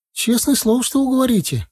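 put-on very high child's voice seeking a promise; hesitant boy. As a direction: ask for assurance like a small boy. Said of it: "потом выдал". (мальчик, высоким голосом, с надеждой)

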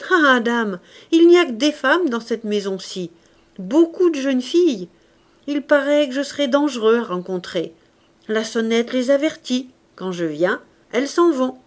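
put-on animated, teasing old gardener; chatty old gardener, sly amusement. A narrator's reading. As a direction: confide it with knowing good humour.

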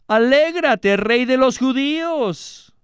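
high, drawn-out mock hail. A narrator's reading. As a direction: soldiers jeering at a false king. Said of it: "le escarnecían diciendo".

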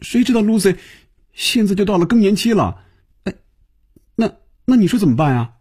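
high-pitched, helpless fluster; flustered, at a loss. A narrator's reading. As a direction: blurt it out high, not knowing what to do. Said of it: "菲比不知所措地说".

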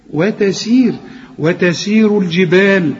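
تلاوة بطيئة لكلمة الجبال; Quran recitation demo. Shown as a demonstration. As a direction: recite الجبال slowly, the jeem softened. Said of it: "الجبال طبعًا أنت عارف الجيم بتعطش".

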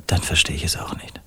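soft whispered puzzlement; whispered bewilderment; hushed. Acted. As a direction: whisper it, at a loss. Baffled reply in hushed tone.

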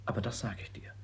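soft voiceless confidence; secret whisper. Acted. Son confides his plan to his sister in a whisper. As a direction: lean in and whisper.

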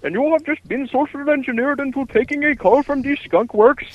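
exaggerated Indian accent, angry call-center worker telling off a prank caller; bad Indian accent. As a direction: rant in a hammed-up sing-song Indian accent. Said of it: "you very bad in Indian accent".

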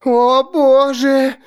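high, drawn-out whimper. Acted. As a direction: whimper it slowly. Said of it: "захныкала Алекс".

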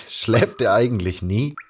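chuckle, then ask slowly; amused question with a laugh. Acted. Narrator asks Velaya about the guard Taurus, laughing. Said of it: "Ich musste lachen".

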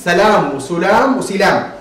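سلام said three times, first with a, then i, then u on the s; salām, silām, sulām in careful succession; three vowelings. Shown as a demonstration. Teacher demonstrating a word with three vowel readings. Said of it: "الكلمة التي تنطق بثلاث حركات".

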